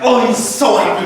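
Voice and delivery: high, excited mock delight; playing up the student's joy; mock happy. Teacher describing a happy student, high and excited.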